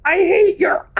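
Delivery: high squeaky shout with a laugh; playful high yell; mock shout. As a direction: shriek it in a silly voice.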